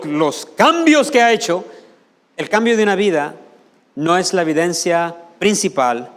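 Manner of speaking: loud, driving sermon delivery; fiery preaching. Acted. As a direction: hammer it home to the congregation. Preaching at full force.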